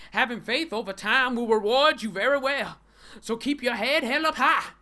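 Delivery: loud, high, hammed-up sermon; mock preacher. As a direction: preach it out, soaring on the big words.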